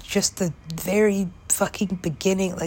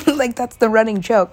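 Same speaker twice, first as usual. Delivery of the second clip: laughing it out, louder and higher; 4 semitones higher, 7 dB louder than usual. Real, not acted. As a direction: giggle through the words. Laughing about running joke.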